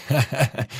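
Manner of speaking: short, high acted chuckle; teasing laugh. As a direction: laugh at your friend's slip. Friend laughs at the retort.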